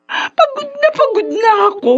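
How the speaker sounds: a mother sobbing that she is worn out; crying. Acted. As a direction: let the exhaustion spill into tears. Weary mother pleads with son.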